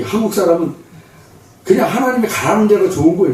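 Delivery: ordinary delivery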